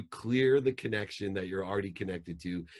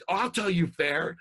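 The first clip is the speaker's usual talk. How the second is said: high, loud furious yell; angry yelling dad; 11 semitones higher, 7 dB louder, slower than usual. Retold or imitated. Dad yells at his missing daughter, high and loud. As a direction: bark it at her like a furious father. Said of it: "imagine if I would have called".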